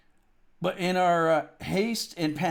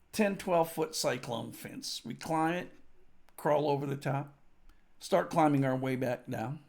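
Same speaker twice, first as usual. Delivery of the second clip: ordinary talk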